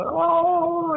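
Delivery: high, drawn-out "oh"; high-pitched wail. Retold or imitated. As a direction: act out a howl of pain. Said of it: "at the highest pitched sound".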